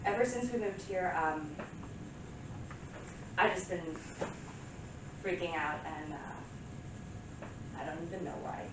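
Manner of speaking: soft, low, halting admission; subdued confession. Acted. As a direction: confess quietly with long, lost pauses. Monologue, subdued confession after outburst.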